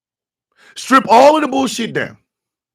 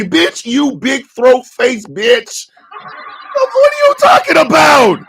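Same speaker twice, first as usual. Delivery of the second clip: furious outburst; 6 semitones higher, slower than usual. Real, not acted. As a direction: tear into her, laughing in disbelief.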